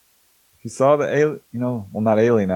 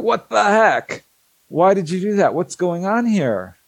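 shocked protest; 8 semitones higher than usual. Retold or imitated. Dolly watches man shrunk.

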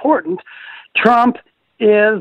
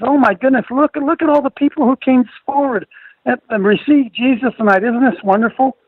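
higher-pitched imitation of delighted churchgoers; gushing crowd quote; 5 semitones higher than usual. Host mimics people marveling at an altar call, gushing. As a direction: gush like an amazed congregation.

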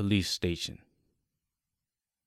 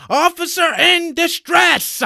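high, loud, drawn-out yell; yelled alarm; 10 dB louder, slower than usual. A narrator's reading. Off-duty policeman with gun out, yelling for help. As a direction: shout for backup. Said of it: "he yells".

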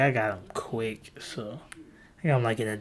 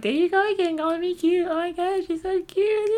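put-on very high squealy girly voice; girlfriend impression. Mimicking girlfriend squealing over compliment, very high.

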